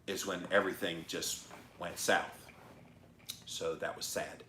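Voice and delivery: normal talking voice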